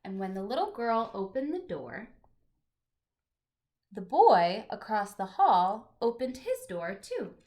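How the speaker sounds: gentle, expressive picture-book reading; reading to children. Acted. Teacher reading a picture book aloud to her class, storytelling voice.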